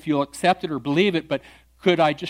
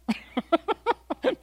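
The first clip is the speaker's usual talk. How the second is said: high imitated chuckle; retold laugh; 12 semitones higher than usual. Pastor imitating the atheist's laugh, light chuckle. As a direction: laugh along.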